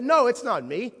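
Peter's denial said high and loud. Acted. Peter at the fire fiercely denying Jesus, loud denial. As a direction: shove the accusation away.

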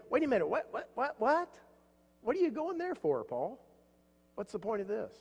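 an imagined reader thrown by Paul; baffled reader. Retold or imitated. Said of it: "you may say".

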